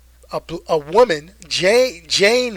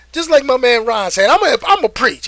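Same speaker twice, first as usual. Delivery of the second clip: preaching; 6 semitones higher, 7 dB louder than usual. Real, not acted.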